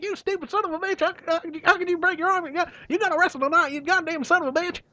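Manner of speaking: high, furious Southern scream; Cornette screaming. Retold or imitated. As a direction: chew out the wrestler in a rage. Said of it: "Cornette just kinda screamed at him".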